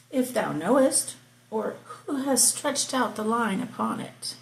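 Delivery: measured, declaiming Bible reading; scripture reading. Acted. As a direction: read it out like from the pulpit. Reads Job 38 aloud.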